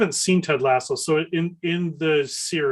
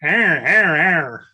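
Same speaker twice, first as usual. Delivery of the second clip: mock accent growl; 2 semitones higher, 6 dB louder, much slower than usual. Mimicking Michigan accent growl.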